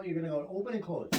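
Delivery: usual speaking voice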